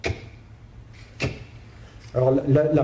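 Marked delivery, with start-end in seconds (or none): 0.0-1.3 bare K click released at the back of the tongue; isolated K sound. Shown as a demonstration. Lecturer demonstrating the sound to students, isolated K consonant burst. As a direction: say K as a lone consonant burst, not the letter name. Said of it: "prononcer le son".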